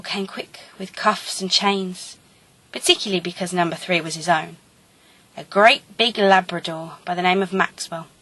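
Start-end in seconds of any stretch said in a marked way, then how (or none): none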